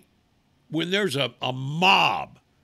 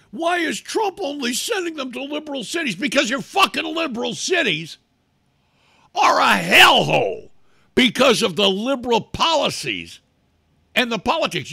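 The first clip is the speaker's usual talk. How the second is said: raised, much louder yelling about the cities; furious shout; 8 semitones higher, 6 dB louder than usual. Real, not acted. Ranting man yells at liberal city policies, furious. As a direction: shout it out in real rage.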